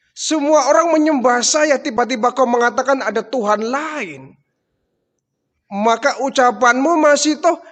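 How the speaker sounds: high, loud furious rant. Acted. Pharaoh ranting at Mas Sito that all Egypt worships him, high loud fury. Pharaoh raging at defiant servant.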